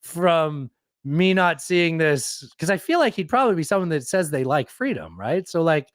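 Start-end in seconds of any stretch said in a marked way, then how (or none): 0.0-2.5 slow, mock-pompous slogan; mocking his voice. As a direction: parody a self-important freedom speech. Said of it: "you seem to be like".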